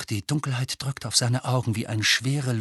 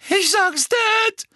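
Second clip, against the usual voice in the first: high, loud frightened whine; whimpering; 6 dB louder, slower than usual. A narrator's reading. Dudley whimpers, threatening to tell his dad. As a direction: whine like a scared child. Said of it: "wimmerte Dudley".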